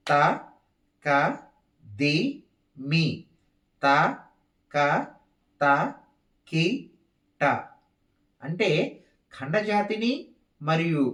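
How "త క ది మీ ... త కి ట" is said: steady, spaced-out beat recitation; counted rhythmic syllables. Shown as a demonstration. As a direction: sound out the tala one syllable at a time. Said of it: "ముందు చతుస్ర జాతి వేస్తున్నాను".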